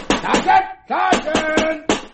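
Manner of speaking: loud, high call for the sergeant. Acted. Prisoner calls the sergeant to be let out, loud high call. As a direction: shout down the corridor for him.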